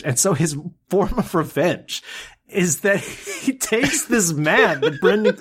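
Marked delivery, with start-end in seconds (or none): 2.5-5.4 giggling through the words; laughing. Real, not acted. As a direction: laugh while telling it. Laughing while retelling.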